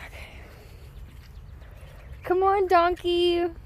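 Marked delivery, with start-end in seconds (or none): 2.2-3.5 high, slow sing-song call; calling sweetly. Real, not acted. Coaxing the little donkey over with a high call. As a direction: draw it out high to coax him.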